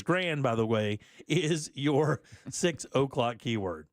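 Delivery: normal talking voice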